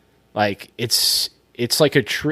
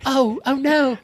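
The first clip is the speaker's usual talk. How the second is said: high, fluttery mock British accent; hammed-up British heroine, pitched way up; British period-drama accent; 11 semitones higher, slower than usual. Austen heroines among girlfriends, high mock British accent. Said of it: "when I'm doing the impressions".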